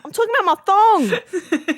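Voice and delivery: high, drawn-out protest; mock exasperation. Holding up the shoe to him, loudly exasperated. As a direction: insist on it with hammed-up frustration. Acted exasperated clarification about footwear.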